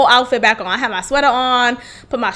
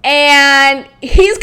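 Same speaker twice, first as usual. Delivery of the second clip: ordinary delivery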